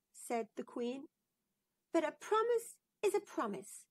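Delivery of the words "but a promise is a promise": much higher, insistent little princess; a princess holding her mother to her word. Acted. Princess reminds the queen, in a high insistent voice. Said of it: "said the princess".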